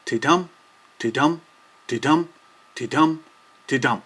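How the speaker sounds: ti-dum with the beat on dum, weak ti then strong dum; iambic pulse. Shown as a demonstration. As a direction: tap out ti-dum like a heartbeat, five times. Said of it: "The characteristic pulse for iambic poetry is".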